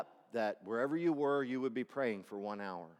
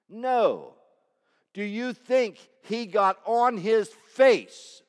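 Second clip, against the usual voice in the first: loud, high-pitched preaching, slow on key lines; preaching at full force; 8 semitones higher, 13 dB louder, slower than usual. Acted. Pastor to congregation about the king, preaching full force. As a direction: drive the story home to the church.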